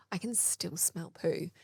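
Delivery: puzzled quiet aside to herself. Retold or imitated. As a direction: say "I can still smell poo" softly and slowly, voiced, to yourself. Retold puzzled realisation.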